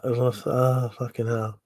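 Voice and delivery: normal talking voice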